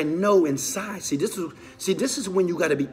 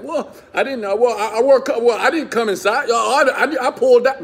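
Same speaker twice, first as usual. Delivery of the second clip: much higher, louder whiny excuses with a laugh; excuse-making guy; 9 semitones higher, 8 dB louder than usual. Retold or imitated. Guys dodging responsibility for a pregnancy, in a high whiny excuse voice. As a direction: play a guy squirming out of blame. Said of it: "some guys are like".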